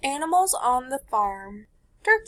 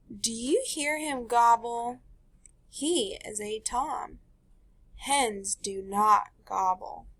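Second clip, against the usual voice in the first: bright storybook read-aloud; teacher reading a picture book to the class; reading to children; slower than usual. Acted. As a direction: read it to a class of little kids.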